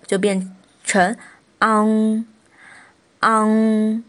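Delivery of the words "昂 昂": 昂 held long and level into ng; back nasal ending. Shown as a demonstration. Teacher modelling the back nasal for learners, 昂 drawn out. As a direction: sustain 昂 on the ng, twice with a pause. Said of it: "昂这个读法了".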